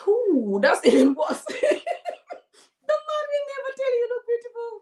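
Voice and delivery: high squealing laughter; real high laughing fit. Real, not acted. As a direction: laugh so hard the words go up. Laughing about bald hair.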